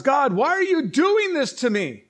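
high, loud cry to God; protesting wail at heaven; anguished complaint. Acted. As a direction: plead upward in mock anguish.

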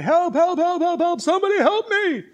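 high, frantic shouts for rescue; yelling for help. Acted. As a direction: yell desperately from a cliff branch. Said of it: "he's yelling".

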